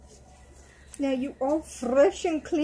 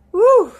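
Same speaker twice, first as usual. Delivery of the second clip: pretend pregnant puff; 8 semitones higher, 13 dB louder than usual. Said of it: "hard to carry those around".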